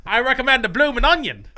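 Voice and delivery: loud, high mock Aussie accent; hammed-up Australian server voice; Australian waitress. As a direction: push the special in broad Australian. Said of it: "That's what they say".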